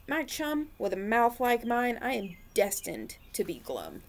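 lively picture-book read-aloud; reading to children. A narrator's reading. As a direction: read it like storytime for little ones.

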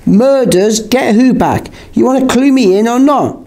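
high-pitched, incredulous questioning; mild shouted demand. A narrator's reading. George demands to be clued in, high mild shout. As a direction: demand answers, pitched well up. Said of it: "George shouted, interrupting us".

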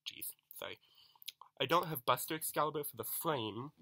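ordinary talk, nothing marked

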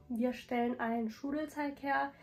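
ordinary talk

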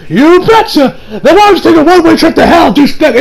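fake-sweet set-up to an insult; sassy woman. Woman telling off the creep, high mock sweetness. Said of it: "He's like".

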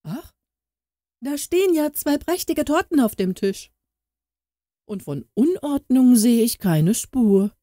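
high, pointed mock amazement; astonished. A narrator's reading. Aunt discovering the hidden cakes, high astonished exclaim. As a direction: exclaim in sly surprise. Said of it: "rief erstaunt aus".

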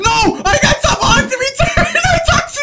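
loud, high outraged yell; real indignant shout. Real, not acted. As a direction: shout it in disbelief. Killed by tomahawk.

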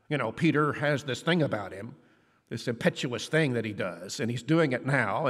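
ordinary talk

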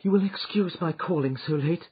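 high, strained voice of a lady in trouble; apologise, barely holding together; distressed lady. Acted. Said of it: "she began".

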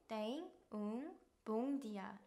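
very slow, syllable by syllable; slow demo pronunciation. Shown as a demonstration. Teacher modelling a phrase for students, very slow. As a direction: spell out "Tenga un buen día" slowly for learners. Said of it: "말씀하시면 됩니다".

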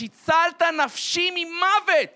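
high, loud, drawn-out proclamation; cry of revelation. Acted. Grandfather finally understands his psalm, cry of revelation. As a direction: proclaim the verse as a sudden revelation. Sudden realisation of the verse.

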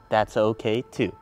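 ordinary delivery

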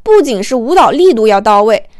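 plain reading voice

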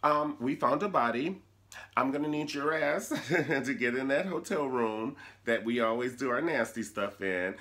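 high, flamboyant phone voice; sassy boyfriend voice. Retold or imitated. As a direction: camp it up on the phone. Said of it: "He was like".

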